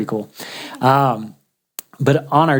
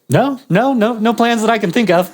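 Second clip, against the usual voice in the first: higher, laughing through the words; amused denial, laugh breaking in; 9 semitones higher than usual. Retold or imitated. Traveller to friend, laughing that he has no plans.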